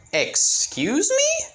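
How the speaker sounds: very high, slow indignant squeal; offended. Acted. Izuku insulted by Naruto, squealing it out high and indignant. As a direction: drag it out, insulted.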